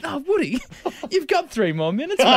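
mock a perky publicist; cheery PR person. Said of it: "the P R person said".

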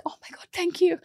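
overwhelmed by a stranger's kindness; touched surprise. Retold or imitated. Mum thanking kind stranger, touched and soft. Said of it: "And I was like".

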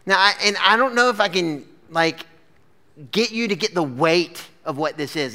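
normal talking voice

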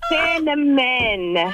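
cinnamon stretched out very slowly; laboured, drawn-out cinnamon; speech-therapy careful. Real, not acted. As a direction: sound out cinnamon slowly, syllable by syllable. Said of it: "how many years of speech therapy I went through".

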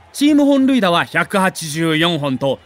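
ordinary delivery

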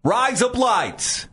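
high, slow Australian-sounding phrase; mock Australian accent. Shown as a demonstration. Showing cohost the trick phrase, mock Australian accent. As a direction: pitch it up, slow, like Australian "razor blades". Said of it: "razor blades with an Australian accent".

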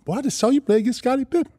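lightly hyped retelling; a touch of a squeal; imitating Zeke. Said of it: "When he said that".

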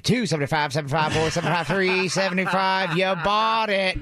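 playful auctioneer. Pretend. Co-host pretending to be an auctioneer, playful chant. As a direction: chant the bids, then stretch the sale call. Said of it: "is actually an auctioneer".

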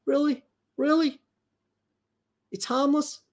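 high-pitched, scornful disbelief; incredulous. Real, not acted. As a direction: throw the claim back, voice leaping up. Incredulous comeback to quoted claim.

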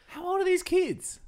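high, shocked disbelief; incredulous. Real, not acted.

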